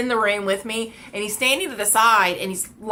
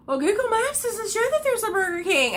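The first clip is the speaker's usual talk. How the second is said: high, whiny mock voice of the woman; high mock voice of the woman with the phone; 13 semitones higher than usual. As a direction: parody her stubborn reply. Mimicking the stubborn woman.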